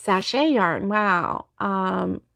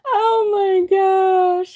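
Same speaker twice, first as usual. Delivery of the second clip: crying laughter; 10 semitones higher, much slower than usual. Real, not acted. Laughing so hard it cries.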